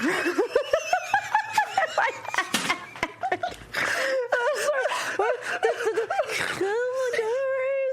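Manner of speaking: long, high cackling laugh; real helpless laughter. Real, not acted. Host cracking up over a forgotten word, helpless laughter. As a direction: crack up completely, high and drawn out.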